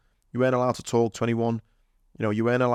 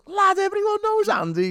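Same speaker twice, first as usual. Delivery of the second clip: very high, jokey squeal; hammed-up falsetto banter; joking mock exclamation. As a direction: imitate a mate joking, voice shooting right up. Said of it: "like, joking like that".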